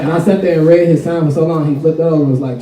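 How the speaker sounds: ordinary talk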